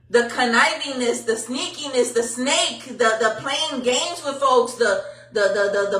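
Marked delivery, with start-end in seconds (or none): none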